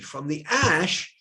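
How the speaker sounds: ordinary talk, nothing marked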